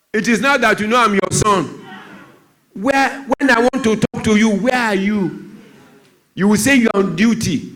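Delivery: put-on high, laughing, bitter boy's voice; teenage son's complaint. Son confronting absent parents, high bitter voice. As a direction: accuse the busy parents, slowing on the last question. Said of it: "The boy said".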